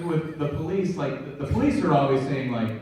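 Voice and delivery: ordinary delivery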